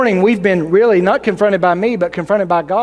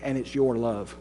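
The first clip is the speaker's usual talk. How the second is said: much lower and softer voice of God; God's quiet rebuke; 7 semitones lower, 10 dB quieter than usual. Acted. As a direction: sink low and quiet to land the point. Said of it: "and God responds".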